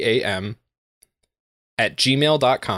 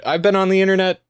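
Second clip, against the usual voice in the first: mock offended; 9 semitones higher, 3 dB louder than usual. Mock offended reply to host.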